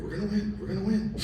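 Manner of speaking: high, soft, urgent chant; will him to win, quietly; hushed pep talk. Retold or imitated. Dad to his son walking the course, hushed and urgent.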